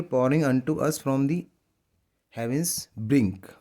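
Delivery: drawn-out reading of a poem line; slow poem recitation. Shown as a demonstration. Teacher reading a poem line to students, slow recitation. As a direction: read each word very slowly for the class.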